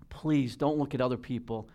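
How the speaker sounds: normal talking voice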